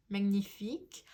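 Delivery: magnifique said the French way, nasal French vowels; French word. Real, not acted. Tarot reader naming a French word she heard in her head, magnifique in French. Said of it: "the French word".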